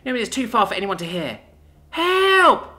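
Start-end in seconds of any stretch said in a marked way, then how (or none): 1.9-2.7 loud, very high cry for help; scream. A narrator's reading. Child screaming towards town for rescue, high scream. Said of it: "I scream back the way we came".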